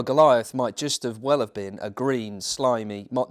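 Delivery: ordinary delivery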